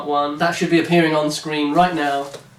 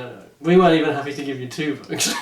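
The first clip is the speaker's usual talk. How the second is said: usual speaking voice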